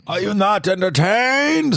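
weird, high, loud mock-gladiator voice; drawn-out, hammed-up gladiator roar; Bane. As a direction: bellow it slowly in an odd villain voice. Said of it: "he sounded like Bane or something".

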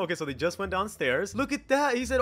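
usual speaking voice

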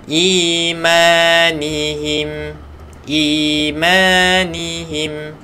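"Imanihim" drawn out slowly; slow recitation. Shown as a demonstration. Teacher modelling "Imanihim" for the class, slow chant.

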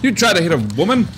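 high, loud, fast indignant challenge; mock outrage. Gamer to the monster chasing her, mock outrage. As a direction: play up being affronted at the attacker.

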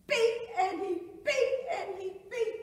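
high, loud, pounding repetition; anguished emphasis. Acted. Survivor reliving the billy stick, loud pounding anguish. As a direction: strike each repeat like a blow.

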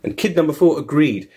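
ordinary delivery

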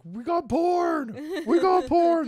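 high, loud triumphant cheer; overjoyed. Retold or imitated. Imitating brother and friend once the download finishes, gleeful shouting. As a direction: shout it like hammed-up victory news. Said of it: "They were so happy".

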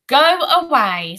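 high, loud, drawn-out brush-off; bully's dismissal. A narrator's reading. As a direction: shove him away with the words. Bully pushes boy off.

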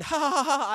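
mock laugh in a run of ha-has; imitated prankster laugh. Nephew running off after tricking uncle, mock laughing. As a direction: act out a gleeful getaway laugh. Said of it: "I ran off laughing".

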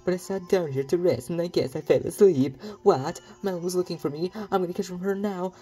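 high, loud, hammed-up Mario accent; bad Mario accent. Ranch owner who fell asleep fretting, in a bad Mario accent. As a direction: push a badly faked Mario accent high and silly. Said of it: "that was a really bad Mario accent".

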